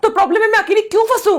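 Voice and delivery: heated, rushed accusation; angry protest. Acted. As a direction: fire it back indignantly. Quarrel over who shares the blame.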